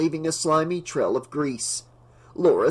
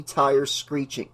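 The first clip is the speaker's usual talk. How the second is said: ordinary delivery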